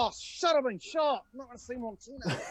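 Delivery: high-pitched parody of the movie line; exaggerated comic protest, pitched well up; mock outburst. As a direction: whine it up high, hamming it. Joking alternative version of film line.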